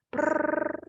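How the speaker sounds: rolled lips drum roll sound. Shown as a demonstration. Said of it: "Drum roll, please".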